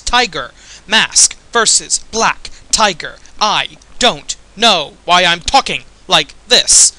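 halting, punched-out words with odd breaks; slow, choppy mock-dramatic delivery; William Shatner impersonation. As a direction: declaim it word by word, loud and staccato. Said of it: "doing my William Shatner impersonation".

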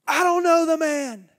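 high, loud angry oath; furious swearing. Acted. Peter swearing to the bystanders, angry shout. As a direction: swear it at them in a rage. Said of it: "began to call down curses".

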